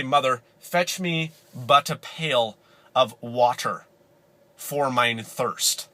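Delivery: very slow, mock old-time speech; nineteen-hundred pioneer. Pioneer child requesting water from Mother, slow mock-archaic. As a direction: draw it out like a formal pioneer child. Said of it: "I probably wouldn't say it like that because this is nineteen hundred".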